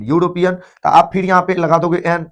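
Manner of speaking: ordinary delivery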